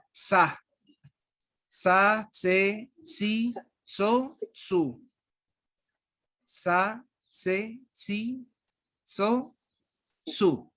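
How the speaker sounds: "tsa, tse, tsi, tso, tsu" with a crisp ts at the start of each syllable; teacher modelling the ts syllables one by one; ts sound drill. Shown as a demonstration. Teacher drills the class on syllables, crisp ts sound. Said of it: "es parecido a juntar la T con, con la Z o con la S".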